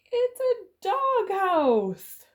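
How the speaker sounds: high, slow, loud alarmed cry. Acted. Chicken discovers the dogs, high frightened cry. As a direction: shriek it out in horror. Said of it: "The chickens flounced".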